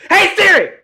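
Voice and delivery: very high, loud shout; sudden piercing high shout; exasperated yell. Real, not acted. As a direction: yell at the phone in frustration. Frustrated shout at assistant.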